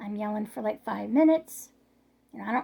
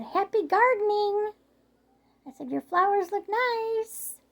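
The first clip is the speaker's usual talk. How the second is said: mock sweet; 10 semitones higher, 4 dB louder than usual. Calling sweetly to ignoring neighbour.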